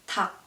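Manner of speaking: 닭 as 닥, the L silent; 닭 with only its second final consonant sounded; only G pronounced. Shown as a demonstration. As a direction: end 닭 on a G stop, no L. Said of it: "you only pronounce G".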